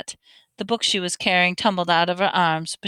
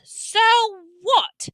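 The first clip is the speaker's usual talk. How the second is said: much higher, defiant shout; 12 semitones higher, 3 dB louder than usual. A narrator's reading. Harry rebuking his friends, defiant shout. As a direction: fling it back at them. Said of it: "Harry shouted".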